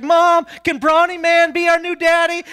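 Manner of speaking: put-on high, pleading child voice; imitated eager kids. Kids ask mom at dad's imagined funeral, eager. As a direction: play excited children begging mom.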